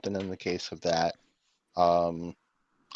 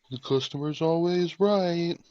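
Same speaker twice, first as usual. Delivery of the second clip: higher, slow sarcastic recital; mock slogan; 9 semitones higher, slower than usual. Listener mocking the store slogan, sarcastic recital. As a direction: recite it with an eye roll.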